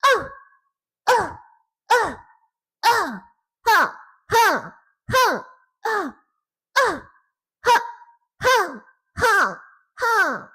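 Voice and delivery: loud, very high female fighting cries; sharp high "oh" and "ha" strikes; attack shouts. Shown as a demonstration. Streamer attacks as the female character, loud high cries. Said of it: "I'm gonna go ahead and attack".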